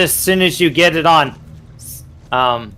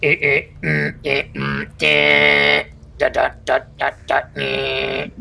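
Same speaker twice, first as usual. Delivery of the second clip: garbled droid beeps; stuttering broken robot noises; 3 semitones lower, 5 dB quieter than usual. Acted. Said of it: "it sounds like".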